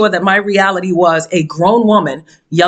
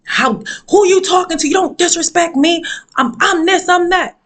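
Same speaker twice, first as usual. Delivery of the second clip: high-pitched, acted-out confrontation; going all-out ham; 11 semitones higher than usual. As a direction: mimic someone blowing up, pitched way up. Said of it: "went all the way ham".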